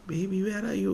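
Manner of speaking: mother faking going far away, fading. Acted. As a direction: let the voice drift away. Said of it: "she reduces the volume to as though she's gone far away".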